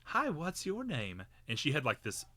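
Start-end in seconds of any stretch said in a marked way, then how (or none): 0.1-1.2 high, drawn-out greeting from a stranger; imitated older woman. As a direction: put on a high voice, stretching the words. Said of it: "this gray-haired woman who I'd never met".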